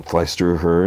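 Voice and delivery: ordinary talk, nothing marked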